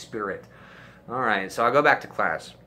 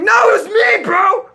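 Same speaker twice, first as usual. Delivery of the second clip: much higher, loud shout; shouting at classmates who won't believe him; 13 dB louder than usual. Retold or imitated. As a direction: yell to be believed at last. Insisting to doubters.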